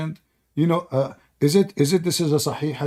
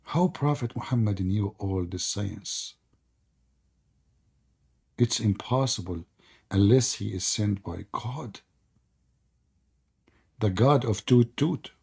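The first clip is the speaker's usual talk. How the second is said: slow, low mock awe; sarcastic; 7 semitones lower, 6 dB quieter, slower than usual. Real, not acted. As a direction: marvel sarcastically, slow and quiet.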